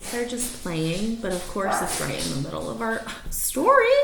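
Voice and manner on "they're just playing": usual speaking voice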